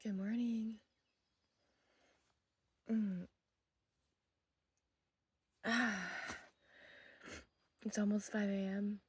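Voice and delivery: hushed early-morning voice; sleepy quiet. Real, not acted. Just woke up, 5 am.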